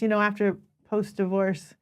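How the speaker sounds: normal talking voice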